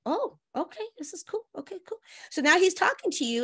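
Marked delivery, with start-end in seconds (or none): 0.1-2.0 higher, softer pleased murmur; woman quietly thrilled he talks to her; hushed thrill. Retold or imitated. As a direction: keep the excitement under your breath. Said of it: "And you're like".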